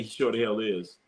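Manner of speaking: usual speaking voice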